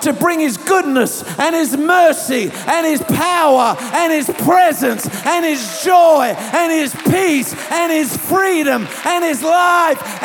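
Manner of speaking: high, impassioned sermon delivery; rousing declaration to a congregation; preaching at full force. Real, not acted.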